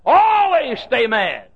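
loud, high cry with a slow, heavy final phrase; shouted preaching. Retold or imitated. Preacher retelling Roloff's sermon, shouting. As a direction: drive the command home. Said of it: "He said".

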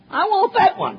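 put-on high childish whine of a greedy king; mock the king who wants one little vineyard.

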